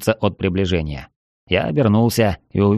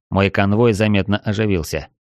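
normal talking voice

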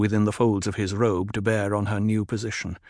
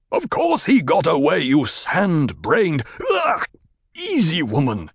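strained Stilgar; 7 semitones higher than usual. Acted. Captive leader scolds his man while being choked. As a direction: snap at his man, then wince under her grip.

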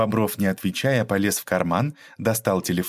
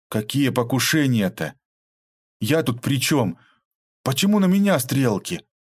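нарастающая паника; 5 semitones higher than usual. Acted. Испуганный Михаил оправдывается.

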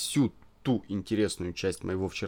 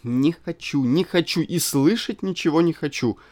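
waving off; 6 semitones higher, 6 dB louder than usual. A narrator's reading. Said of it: "отмахивалась она".

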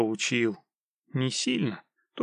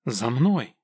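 usual speaking voice

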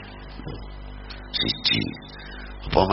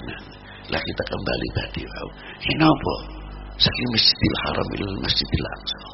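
normal talking voice

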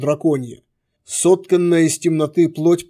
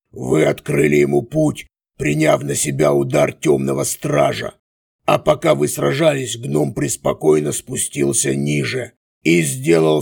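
sneering villain. A narrator's reading. Villain monologue.

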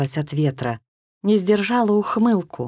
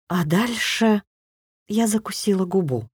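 ordinary delivery

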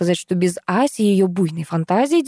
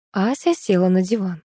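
ordinary delivery

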